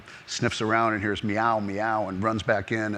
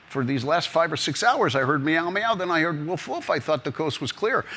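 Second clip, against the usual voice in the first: lightly voiced puzzled mouse, higher pitch; a hint of a bewildered little mouse, pitched up; 7 semitones higher than usual. Mouse about to be eaten questions the cat, lightly puzzled.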